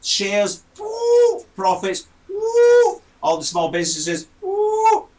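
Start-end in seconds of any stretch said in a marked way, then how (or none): 0.8-1.4 high held "woo" of soaring figures; high sustained whoop. Shown as a demonstration. Sound effect of shares soaring. 2.3-3.0 high, louder held "woo"; high sustained whoop. Shown as a demonstration. Sound effect of profits soaring. 4.4-5.1 high held "woo" as imitated noise; high sustained whoop. Shown as a demonstration. Sound effect for small businesses.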